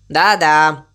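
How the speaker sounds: curt, low brush-off. A narrator's reading. Crow cuts short the cow's musing, curtly. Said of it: "коротко ответил ворон".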